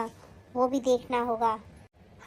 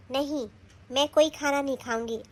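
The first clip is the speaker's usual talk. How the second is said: ordinary delivery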